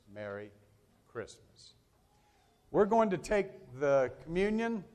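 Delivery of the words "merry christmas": Merry Christmas whispered, much softer, Christmas breathed out voiceless; hushed Merry Christmas. Retold or imitated. Pastor ending the sermon as Simeon, whispering the greeting tenderly. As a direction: drop to a reverent whisper. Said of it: "And so Simeon says".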